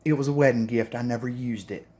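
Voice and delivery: a hint of a prim mother covering up, dropping quiet. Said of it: "She goes".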